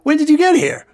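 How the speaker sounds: excited. Acted. Surprised by guest.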